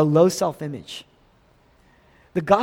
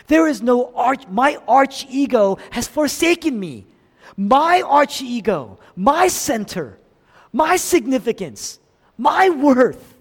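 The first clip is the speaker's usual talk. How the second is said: higher, louder, rising lament; anguished outcry; 7 semitones higher, 4 dB louder than usual. Retold or imitated. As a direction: cry it out as if abandoned. Jesus's forsaken cry, preached.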